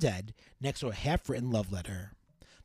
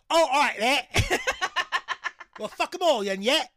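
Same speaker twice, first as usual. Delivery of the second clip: cockney urchin voice; 7 dB louder than usual. Retold or imitated. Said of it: "the other Dickensian".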